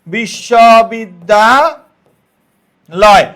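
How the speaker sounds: বিশ্ববিদ্যালয় stretched very slowly, each syllable held. Shown as a demonstration. Teacher slowly sounding out the word. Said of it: "বিশ্ববিদ্যালয় শব্দে কয়টি অক্ষর".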